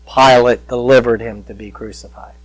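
ordinary talk, nothing marked

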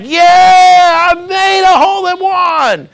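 high, drawn-out victory shout; acted ecstatic cheer, stretching the yeah; so excited. Golfer celebrating his hole in one, excited shout. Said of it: "He's so excited".

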